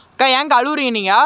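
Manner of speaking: high, loud plea to a crying spirit; calling out. Real, not acted. Ghost hunter asks the crying sister why she weeps, loud. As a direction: call to her and ask why she cries.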